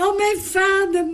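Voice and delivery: high, ringing cry of joy; joyful cry. Acted. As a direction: call out to his father, high and glad. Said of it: "riep hij".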